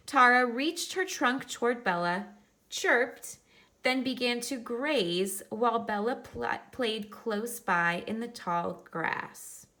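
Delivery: picture-book reading voice; reading to children. Acted. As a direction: read it out brightly for the class. Reads picture book aloud to class.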